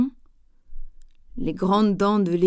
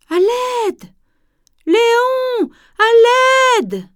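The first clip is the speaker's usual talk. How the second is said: high, loud panicked cry for help from afar; terrified cry; 14 semitones higher, 6 dB louder, much slower than usual. A narrator's reading. Mimi calling Léon for help, terrified and high. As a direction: scream for help as if from the distance. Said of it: "Elle a l'air terrorisée".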